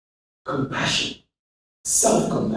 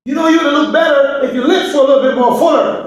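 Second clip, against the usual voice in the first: higher, quick needling remark; mock critic; 9 semitones higher, faster than usual. Speaker voicing someone nagging a teen, needling. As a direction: tease about fuller lips.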